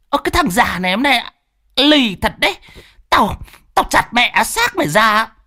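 high, fast furious threat. Acted. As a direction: scream the threat in rage. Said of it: "tức sôi máu".

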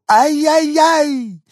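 very high, loud, slow dog yelp; small dog yelping with hunger. Acted. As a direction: let out a long puppy whine. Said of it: "не выдержал и добавил".

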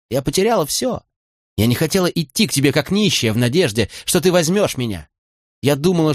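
a hint of a proud girl confessing; Molly, hurt and bitter. A narrator's reading. Molly confessing her ruin to Fitz, wounded and bitter.

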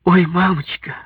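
put-on high, overcome young daughter; tearful delight. A narrator's reading. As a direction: gush through tears in a high voice. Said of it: "сказала Наташа сквозь слёзы".

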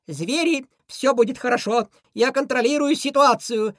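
high, loud reassuring shout. Acted. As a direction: call out over the crowd. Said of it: "крикнул я".

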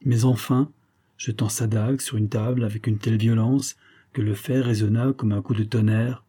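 plain reading voice

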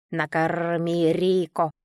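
slow, lightly voiced parrot squawk; a hint of a bird demanding food. A narrator's reading. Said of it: "Он раскрыл клюв и сразу завопил".

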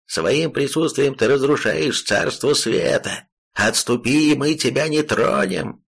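lightly voiced pompous ruler; commanding emperor. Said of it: "крикнул противник".

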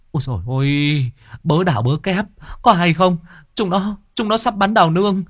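high, panicked cry raising the alarm; frantic shout. Acted. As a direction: yell the news across the market, climbing in pitch. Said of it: "Tiếng kêu vang vọng".

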